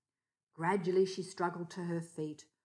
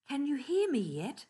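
kangaroo voice; 8 semitones higher, 6 dB louder than usual. A narrator's reading. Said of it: "asked the kangaroo".

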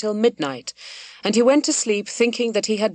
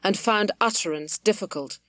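plain reading voice

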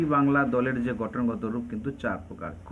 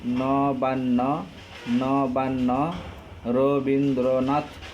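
slowed for syllables; much slower than usual. Shown as a demonstration. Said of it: "তিনটি দল আছে এমন একটা এক্সাম্পল দাও।".

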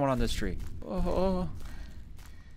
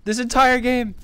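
loud, high, rattled exclaiming; real startled disbelief; shocked outburst; 9 semitones higher, 10 dB louder than usual. Real, not acted. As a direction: blurt it out, shaken by the scare. Just after a jump scare.

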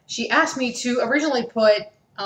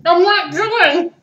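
protesting; 8 semitones higher, 5 dB louder, slower than usual. Real, not acted. Protesting with full mouth.

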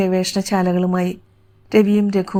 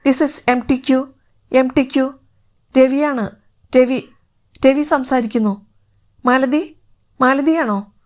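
raised pitch, spaced-out call; radio calling; 5 semitones higher than usual. Acted. Spaceman answers from the rocket by radio, calling out. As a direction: reach out over a distant radio link.

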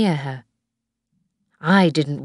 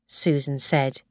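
ordinary delivery, nothing marked

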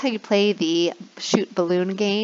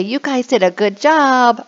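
praise; 5 semitones higher, 5 dB louder than usual. Shown as a demonstration. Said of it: "I'll say".

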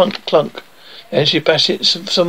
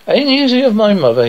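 mildly eager, pitched higher; eager question; 6 semitones higher than usual. A narrator's reading. Young prince asking nanny about his mother, mildly eager. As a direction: ask it high with a touch of eagerness. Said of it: "he said, asked eagerly".

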